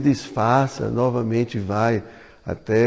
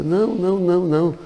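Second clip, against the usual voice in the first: higher, hurried little protest; shy refusal; 8 semitones higher, 3 dB louder than usual. Acted. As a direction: protest shyly, like a timid girl. Radharani shyly refusing to change. (recusa tímida, voz mais aguda)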